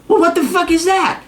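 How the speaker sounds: high, incredulous outburst; baffled, half-shouted question; exasperated disbelief. Retold or imitated. Retold baffled reaction to girlfriend.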